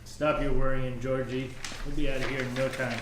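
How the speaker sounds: low, quick reassurance; reassuring. Acted. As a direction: brush off his worry, low and fast. Calming a scared friend.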